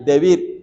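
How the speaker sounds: "debir" with the accent on the I; stress on final I. Shown as a demonstration. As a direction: say "debir" as de-BIR, stressing the last syllable. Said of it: "con el acento en la I".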